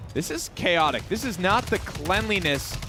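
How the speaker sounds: rising hype, pitched high; excited. Real, not acted. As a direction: build the hype as the fight breaks out. Casting a first versus second fight.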